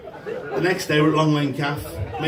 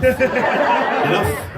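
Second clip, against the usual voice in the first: much higher and louder, barking the order; shouted order; 10 semitones higher, 6 dB louder than usual. Pretend. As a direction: bellow it down the platform like an angry announcer. Announcer bellowing at passengers.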